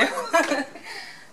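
a bright little giggle; giggling after telling her to continue. Real, not acted.

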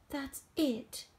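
high cry of defeat; exasperated cry. A narrator's reading. Exhausted George gives up, crying out. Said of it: "he cried".

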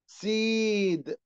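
سيد as a drawn-out "seed"; long E in سيد. Shown as a demonstration. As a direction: hold the "ee" of سيد before the d. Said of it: "أنا بمد في الإي". (مدّ الإي في «سيد»)

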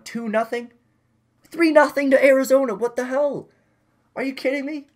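stunned outburst, can't believe the score; shocked disbelief. Real, not acted. Gamer watching his team fall behind, shocked high outburst. Losing three nothing to Arizona.

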